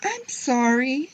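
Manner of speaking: put-on high, drawn-out child's apology; upset little girl's wail, acted; crying out. A narrator's reading. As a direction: cry it out slowly in a high voice. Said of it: "Harriet cried".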